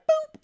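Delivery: high squeaky "Boop" like a phone beep; phone beep imitation. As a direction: imitate the record button beep. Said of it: "she literally was like".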